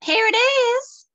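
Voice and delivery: very high, slow, put-on squeak; squeaky eager voice. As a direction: chirp it up to please Edith. Lucy Nell returns eager to please.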